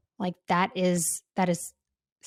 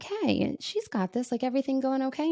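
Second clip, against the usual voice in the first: high, quick, softer check-in; leader checking in; 5 semitones higher, 3 dB quieter than usual. Retold or imitated. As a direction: ask gently and quickly if all is well. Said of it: "she was like".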